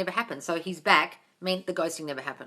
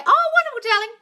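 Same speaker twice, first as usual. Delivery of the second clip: high, loud gushing praise; delighted gush; 7 dB louder than usual. Real, not acted. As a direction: gush over the good news. Reacting to a viewer's comment.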